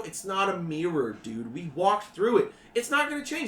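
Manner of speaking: annoyed dude. Acted. GM voices teen.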